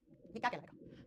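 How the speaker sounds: same question dropped to a hush; teacher repeating the question in a whisper. Real, not acted. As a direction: whisper it like a secret challenge.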